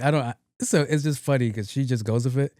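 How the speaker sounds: ordinary talk, nothing marked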